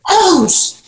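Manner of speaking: high, loud exclamation; retold cut-off curse. Storyteller reliving the lost shoes crisis, high exclaimed curse.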